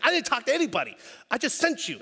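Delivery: high-pitched, indignant mock protest; annoyed wife. Acted wife snapping at husband.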